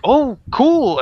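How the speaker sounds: high, loud burst of delight; excited. Retold or imitated. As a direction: shout "Oh, cool!" like a thrilled kid. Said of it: "And I was like".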